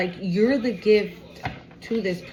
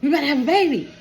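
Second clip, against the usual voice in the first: indignant retort; 5 semitones higher, much faster than usual. Retold or imitated. Panicked partner snaps back.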